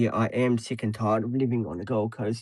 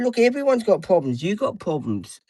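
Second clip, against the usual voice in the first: retold retort; 8 semitones higher than usual. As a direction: snap back at mother, pitched way up. Retold row with mother.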